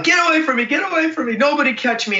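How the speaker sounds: high, pushing them away in a rush; urgent pleading. Retold or imitated. Patient pushes away ER staff.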